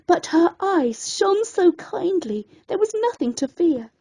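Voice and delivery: plain reading voice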